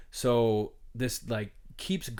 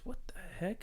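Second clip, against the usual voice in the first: whispered, higher shocked disbelief; stunned whisper; 10 semitones higher, 9 dB quieter than usual. Retold or imitated. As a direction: breathe it out in total shock. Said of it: "everybody goes".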